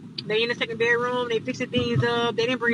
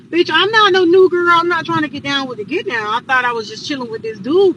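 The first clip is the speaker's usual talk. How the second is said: loud, higher, affronted outburst; indignant retort; 4 semitones higher, 9 dB louder than usual. Retold or imitated. Visitor refusing to be called a new girl, indignant. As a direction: push back hard, re-enacting the offence.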